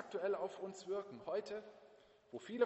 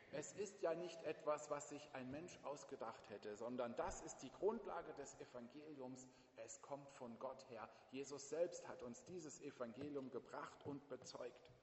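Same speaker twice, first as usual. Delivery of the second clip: soft sincere reassurance; 3 semitones lower, 8 dB quieter than usual. Acted. Softly testifying to the congregation.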